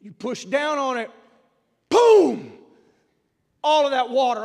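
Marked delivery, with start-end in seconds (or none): 1.9-2.5 loud boom. Retold or imitated. Radiator cap blowing off.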